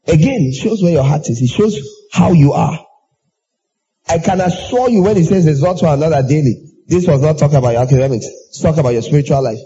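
ordinary talk, nothing marked

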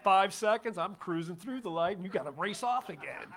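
amused jeer at the highway racer; mocking laugh. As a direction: tease the racing driver through laughter. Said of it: "we always laugh at him now, going".

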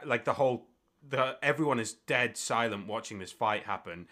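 ordinary talk, nothing marked

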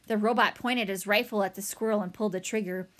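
ordinary delivery, nothing marked

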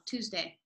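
"Tuesday" as "too-zday", softly. Shown as a demonstration. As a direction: say "Tuesday" quietly with a plain TOO. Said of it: "I say it like T O O".

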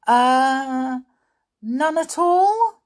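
very high, slow questioning answer; unsure pupil. A narrator's reading. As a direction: guess with a rising, hesitant voice. Molly guessing a prompted answer.

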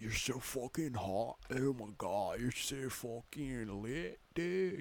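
lazy stoner surfer drawl; surfer dude voice. Retold or imitated. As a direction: lay it on like a laid-back surfer bro. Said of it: "talks like a surfer, dude".

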